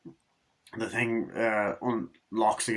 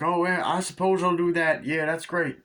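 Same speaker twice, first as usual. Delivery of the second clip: high, loud silly sheriff voice; imitating the sheriff from the movie, high and loud; mock sheriff; 7 semitones higher, 4 dB louder than usual. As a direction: ham up a dopey small-town sheriff. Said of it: "where the sheriff's like".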